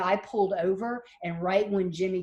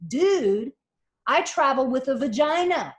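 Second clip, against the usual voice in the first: put-on higher and louder, spelling it out to the clueless boyfriend; pointed punchline; 6 semitones higher, 5 dB louder than usual. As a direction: land it hard, like she's had enough. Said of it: "she finally says to him".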